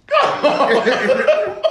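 high laughing "come on"; laughing mock plea. Friend mocking a bad scene, laughing plea.

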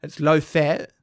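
ordinary talk

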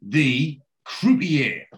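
very slow, drawn-out announcement; dramatic reveal. Real, not acted. Host unveiling the cameo to co-host, dramatic reveal. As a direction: stretch out the big reveal.

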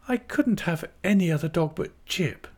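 high, earnest character voice; young wife Dora. A narrator's reading. Dora refusing another dog to her aunt, earnest.

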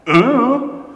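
high, loud baffled grunt; clueless kid. Retold or imitated. Older son asked where brother is, clueless grunt. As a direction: imitate a boy with no idea.

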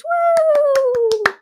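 hammed-up high whoop; high cheering whoop. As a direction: cheer it like a crowd. Mock cheering.

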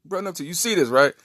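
begging his brother for help; panicked plea. Retold or imitated. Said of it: "I was like".

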